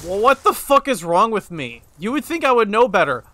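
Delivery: loud, incredulous frustration with yourself; exasperated outburst. Real, not acted. Exasperated at own mistake.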